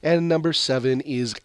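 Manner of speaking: upbeat chart-countdown read; radio countdown announcer. Acted. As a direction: call out each ranking like a radio host. Announces the top song chart.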